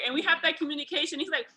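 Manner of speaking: normal talking voice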